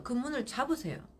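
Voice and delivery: ordinary talk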